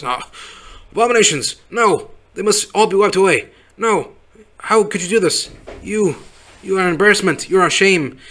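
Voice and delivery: high-pitched outraged ranting bigot; mock-horrified bigot railing in a high voice. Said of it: "he's like a stereotypical, like, racist stereotype".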